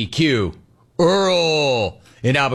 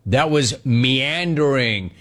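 slow, stretched "meandering"; drawn-out word; slower than usual. Real, not acted. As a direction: draw out every syllable of "meandering". Drawing out a big word.

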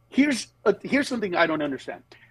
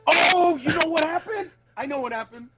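surprised realization; 10 semitones higher than usual. Real, not acted. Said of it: "My wife turned the car on".

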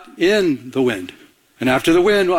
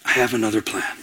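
lower, much softer, calm and gentle; gentle soft God voice; 6 semitones lower, 5 dB quieter than usual. Acted. God answering Elijah's rage in a lower, softer, gentle voice. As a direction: say it like a still small voice. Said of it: "And God's like".

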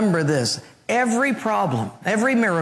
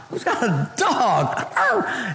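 usual speaking voice

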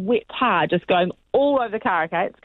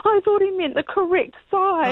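high, teary whine, imitated; hammed-up tearful protest; mock crying; 13 semitones higher than usual. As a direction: whimper out a weak excuse. Friend explains mistake, upset.

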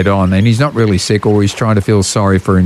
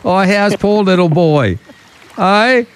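put-on higher, teasing baby-talk pity; mock pity; 8 semitones higher than usual. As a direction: pretend to feel sorry for him. Mocking sick colleague.

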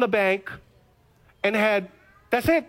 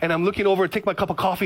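ordinary delivery

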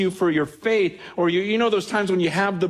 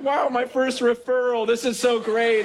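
mock delight; 6 semitones higher than usual. Church lady thrilled by referral.

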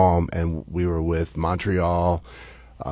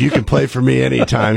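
louder, faster, hearty praise; gruff approval; 6 semitones higher, 7 dB louder, faster than usual. Retold or imitated. Old-school manager to his young catcher, loud hearty approval. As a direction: slap him on the back with it.

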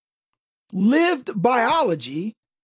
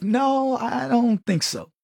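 normal talking voice

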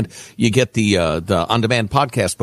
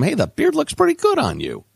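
mock admiring friend. Mocking praise from friends.

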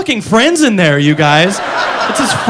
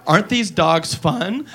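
put-on girl in the ad; 3 semitones lower, 7 dB quieter, much slower than usual. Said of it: "now she goes".

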